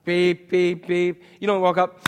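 ordinary talk

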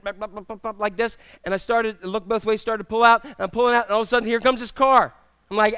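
ordinary talk, nothing marked

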